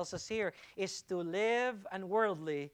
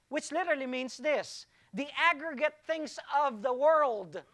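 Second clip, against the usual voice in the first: higher and louder, near shouting; full-force preaching; 7 semitones higher, 10 dB louder than usual. Acted. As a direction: preach it hard to the congregation.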